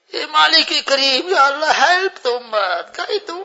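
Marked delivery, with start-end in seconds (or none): none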